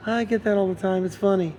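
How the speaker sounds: Michael laughing it off, high voice; Michael's voice. Retold or imitated. Quoting Michael's reply.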